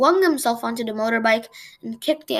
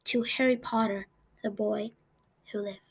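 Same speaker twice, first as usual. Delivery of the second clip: softer hushed voice; hushed toast; 2 semitones lower, 7 dB quieter than usual. A narrator's reading. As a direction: raise a glass in a hush. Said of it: "in hushed voices".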